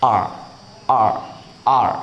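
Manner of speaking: आर without any h; pron demo. Shown as a demonstration. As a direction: open आर on the vowel alone. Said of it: "इसको आर पढ़ा जाता है".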